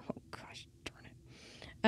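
whispered little curse under her breath; a breathy oops whispered to herself. Real, not acted. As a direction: hush it as if to yourself. Mutters a mistake under her breath.

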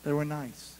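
soft gentle saying of they were nice. Shown as a demonstration. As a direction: drop it low and tender. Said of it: "here's how I say it".

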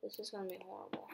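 fast, breathy whisper of dread. Real, not acted. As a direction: mutter it under your breath. Muttering dread while playing.

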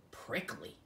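ordinary delivery

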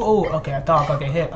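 ordinary delivery

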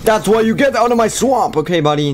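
high, loud yelled swamp line; Shrek shout. Pretend. Gamer yelling at enemy players, Shrek yell. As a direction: bellow it like an angry ogre. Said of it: "Hashtag Shrek's swamp".

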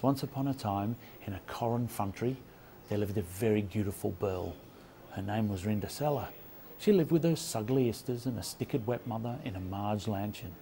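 swapped first sounds, recited like a fairy tale; spoonerism. Shown as a demonstration. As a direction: read a jumbled Cinderella tale with swapped sounds. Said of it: "It goes like this".

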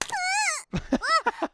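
squealing high laugh; high-pitched giggle. Real, not acted.

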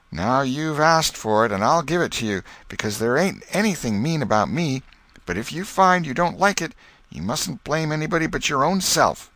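put-on a mischievous boy warning his cat; young Tom, cocky and teasing; Tom's boyish voice.

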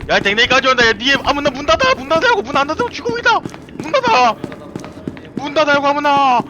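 high panicked yelling at teammates; being chased. Real, not acted. Monster coming, yelling at teammates.

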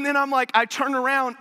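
ordinary talk

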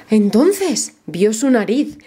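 ordinary delivery, nothing marked

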